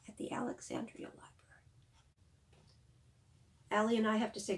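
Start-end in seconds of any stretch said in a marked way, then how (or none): 0.1-1.3 quick hushed aside; playful whisper. Real, not acted. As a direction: whisper the name like a secret.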